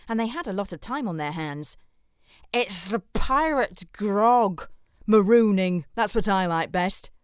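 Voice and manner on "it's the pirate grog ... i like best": loud, slow pirate bellow. A narrator's reading. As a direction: roar it like a rowdy pirate. Another pirate joins the argument.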